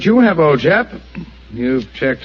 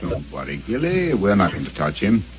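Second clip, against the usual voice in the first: gentle reassurance; 3 semitones lower, 6 dB quieter than usual. Acted. Holmes soothing a frightened boy.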